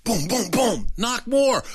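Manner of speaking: usual speaking voice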